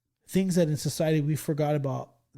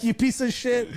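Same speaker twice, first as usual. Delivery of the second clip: higher-pitched, amused retold insult; mock-angry insult; 8 semitones higher, 4 dB louder than usual. Former student insults old teacher, mock angry. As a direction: replay an old grudge for laughs. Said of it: "I was still mad".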